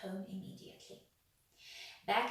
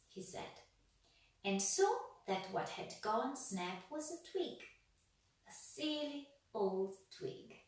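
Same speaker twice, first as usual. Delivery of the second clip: ordinary delivery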